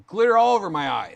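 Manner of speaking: loud, pained shout about the eye; real frustrated yell; angry outburst. Real, not acted. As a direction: yell it out, furious and hurting.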